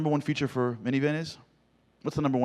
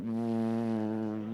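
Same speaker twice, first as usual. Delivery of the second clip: window noise; 2 semitones lower, 5 dB quieter than usual. Retold or imitated. Imitating window rolling up.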